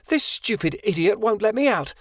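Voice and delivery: high, whiny princess outrage; indignant complaint. A narrator's reading. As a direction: complain like a spoiled royal. Princess complains about guard.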